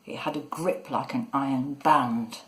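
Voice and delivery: usual speaking voice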